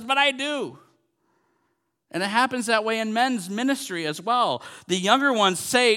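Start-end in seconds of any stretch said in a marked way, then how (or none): 0.0-0.8 high-pitched protesting reply; raised, insistent comeback; retold screaming back. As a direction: protest back in a mock marital row. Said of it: "they're both screaming at each other".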